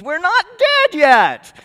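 high, loud, drawn-out comic yell; mock scream. Preacher acting out the wagon's corpses jumping out, screaming. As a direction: scream it out slowly like a whole revived wagonload. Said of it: "jumps out and screams".

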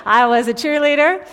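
high, very loud and emphatic; mock boasting. Real, not acted. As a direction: playfully order the crowd to be impressed. Mock boast to laughing audience.

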